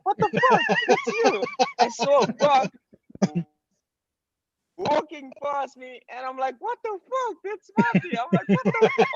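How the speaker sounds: very high-pitched, squeaky disbelief; retold astonishment. Storyteller reliving seeing his friend as a bug, high amazed squeal. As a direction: relive the shock, voice shooting way up.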